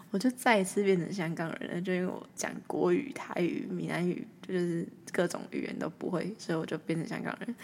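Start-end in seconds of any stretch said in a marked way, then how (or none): none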